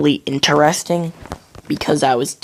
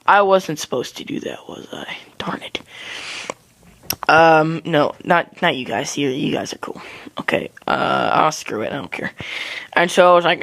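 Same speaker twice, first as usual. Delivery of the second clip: normal talking voice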